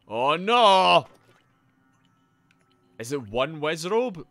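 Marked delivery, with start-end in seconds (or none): 0.1-1.1 loud, high cry of dismay. Real, not acted. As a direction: wail it out loudly. Reacts to trouble in game.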